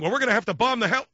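high, loud mock panic; panicked movie character. Parodying the film's dialogue, loud hammed-up panic. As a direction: ham up the doomed crisis dialogue. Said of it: "it's sounding like that".

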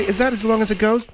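normal talking voice

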